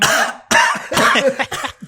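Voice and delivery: high hacking fit of coughing; theatrical choking cough; hammed-up cough. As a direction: cough on cue, overdoing it. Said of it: "should you cough now".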